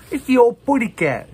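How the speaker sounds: a squeaky little canary spotting the cat; Tweety impression. Showing the Tweety figure to viewers, in a high Tweety voice. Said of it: "Uh, Tweety".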